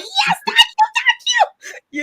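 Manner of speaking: very high, thrilled squeal of thanks; excited squeal. Retold or imitated. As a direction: squeal it like you just got the best news. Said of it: "I was just like".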